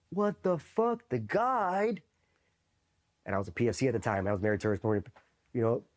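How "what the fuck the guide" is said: high-pitched mock holler of an instructor; imitated angry yell. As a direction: holler it like an outraged instructor, without full volume. Acting out instructors yelling from upstairs.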